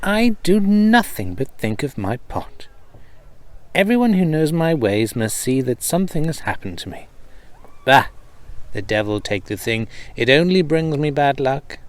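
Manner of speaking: a worried cobbler talking himself out of it; exasperated cobbler. Acted. Cobbler scolds himself aloud.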